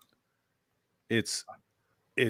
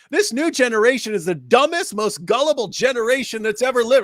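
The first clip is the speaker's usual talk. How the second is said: imitating Bill Maher; 10 semitones higher, 6 dB louder than usual. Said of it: "where he's talking about".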